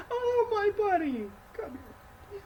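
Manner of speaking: high, crying hammed-up embrace; mock tearful. Thorin tearfully welcoming his friend back, hammed-up crying. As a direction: cry it out in mock emotion.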